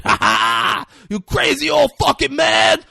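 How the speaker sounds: high, full-volume manic yell with a barked laugh; crazed screaming. Retold or imitated. Imitating a crazy character screaming at everybody, crazed scream. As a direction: scream it like a raving madman. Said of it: "He's screaming at everybody".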